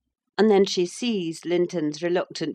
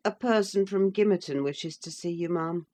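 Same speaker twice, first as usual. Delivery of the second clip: ordinary talk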